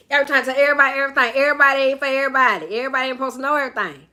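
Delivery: fast, loud country twang; country accent. Shown as a demonstration. Host scolding oversharers, rapid country accent. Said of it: "that's my country accent".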